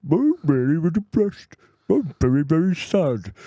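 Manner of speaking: odd high put-on self-pity voice; weird inner monologue voice. Mocking his own depression to a friend, silly voice. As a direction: whine it in a silly made-up inner voice. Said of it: "weird inner monologue voices".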